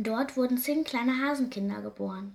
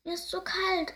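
high, plaintive little hare; sad; 9 semitones higher than usual. A narrator's reading. Cold baby hare telling a sparrow, plaintive and sad. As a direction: complain with a touch of sadness. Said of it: "erklärt Konstantin traurig".

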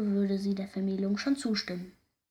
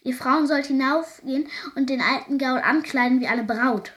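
louder, higher, agitated announcement; aufgeregt rufend; 4 semitones higher, 6 dB louder than usual. A narrator's reading. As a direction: call it out to the women in agitation. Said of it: "rief er aufgeregt". (aufgeregt, mit erhobener Stimme)

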